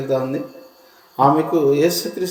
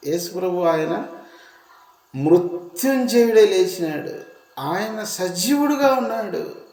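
higher, slow and swelling with rapture; rising fervent preaching; 5 semitones higher than usual. Acted. Preacher tells the congregation Jesus is risen, in rising fervent preaching. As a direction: carry the church up to the risen Lord.

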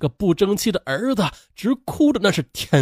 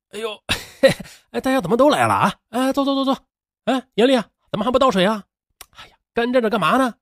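feigned calm; 6 semitones higher, faster than usual. A narrator's reading. Cornered man greeting his angry visitors, feigned calm. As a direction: put on casual cheer, higher-pitched. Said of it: "但他故作镇静地说".

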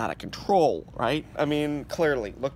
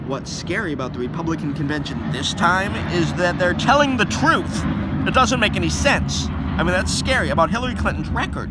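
angry rant; 2 semitones higher, 10 dB louder than usual. Real, not acted. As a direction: shout it out in anger. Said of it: "I lose my voice 'cause I'm, I get angry".

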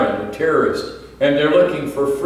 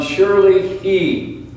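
"surely he" said higher and slower, leaning hard on both words; emphasis on surely he; 6 semitones higher, slower than usual. Shown as a demonstration. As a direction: stress "surely he", a touch slower, pitch raised. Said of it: "the emphasis in verse three is on".

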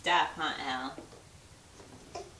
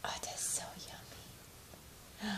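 whispered praise; 14 dB quieter, much faster than usual. Shown as a demonstration. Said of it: "praising baby's food".

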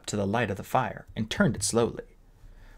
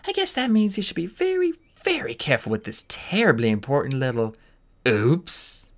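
teasing mockery; 5 semitones higher than usual. A narrator's reading. Queen pretends to drop egg.

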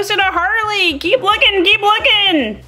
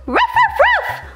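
very high yappy little barks; puppy bark. Pretend. The puppy answers the host, with very high yips. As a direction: yip like a tiny puppy.

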